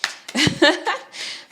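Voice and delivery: loud, high burst of laughter; helpless real laugh; amused laugh. Real, not acted. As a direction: crack up at your own accent. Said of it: "It just makes me laugh".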